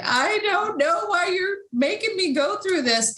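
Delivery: high, tearful plea through a half-sob; crying it out to God; retold bawling. Sick with covid, crying out to God in tears.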